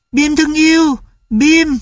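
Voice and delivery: put-on high delighted child voice; a boy hugging his lost dog; overjoyed boy. A narrator's reading. Tulip embraces Bim, high and overjoyed.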